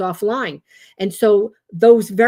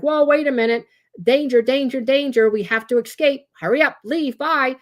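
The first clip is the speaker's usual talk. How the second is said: raised-pitch mock alarm, urgent; brain's alarm; 6 semitones higher, 3 dB louder than usual. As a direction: sound the alarm like a panicking brain. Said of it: "the amygdala, uh, is the brain's alarm center".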